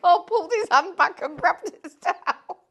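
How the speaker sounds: loud and high, laughing through the words; can barely get the punchline out for laughing. Real, not acted. Punchline of an embarrassing story.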